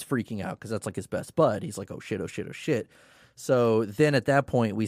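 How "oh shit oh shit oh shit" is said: hushed, panicky muttered curse; panicked under breath. Retold or imitated. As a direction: mutter it in a nervous panic, much softer. Dadab panicking outside the door.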